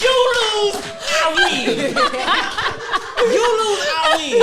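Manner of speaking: high, loud gloating laughter; gloating taunt. Retold or imitated. Athlete taunting the bettors who lost, gloating high. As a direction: crow it over the losers. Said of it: "I said".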